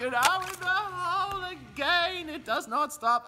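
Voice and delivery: ecstatic call. Pretend. Announcer ecstatic at another hole in one. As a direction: soar up on the call, slow.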